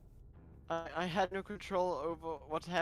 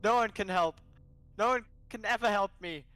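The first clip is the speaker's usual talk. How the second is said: louder, anguished raised voice; loud, upset and insistent; despairing outburst; 4 semitones higher, 6 dB louder than usual. Acted. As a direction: cry it out in despair. Acted argument, despairing outburst.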